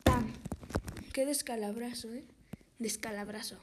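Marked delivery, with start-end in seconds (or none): 0.0-0.3 loud bang effect. Acted. Body falls on their heads.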